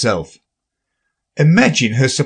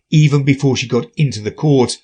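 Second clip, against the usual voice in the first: ordinary delivery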